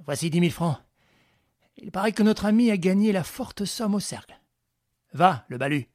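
a lightly voiced confident ringleader; Lupin giving orders. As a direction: bark out instructions to your men. Lupin hands over money.

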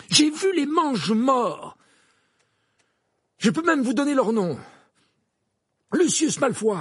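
high, loud, insistent testimony; urgent outburst. Acted. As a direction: call it out loudly to be believed. Harry urgently names the Death Eaters.

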